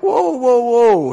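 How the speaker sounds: high, loud startled whoa whoa whoa. Retold or imitated. Retelling being startled when the men grabbed hands, high surprised whoa. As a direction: jump in surprise as the hands come out.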